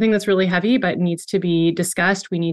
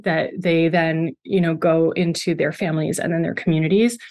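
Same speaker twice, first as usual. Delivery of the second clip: usual speaking voice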